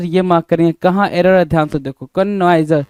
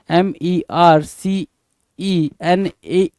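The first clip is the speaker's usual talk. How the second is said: very slow spelling; much slower than usual. Shown as a demonstration. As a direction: spell mercenary out letter by letter, very slowly. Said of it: "mercenary क्या होता है".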